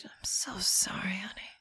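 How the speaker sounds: imitated whispered, doting condolence; mock tender whisper; mum's hushed sympathy. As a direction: whisper it like an over-sympathetic mother. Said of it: "She does say that".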